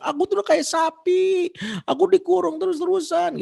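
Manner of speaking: high, loud mock teenage yell; hammed-up bratty teen shouting at dad; child shouting. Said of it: "Dia teriak-teriak".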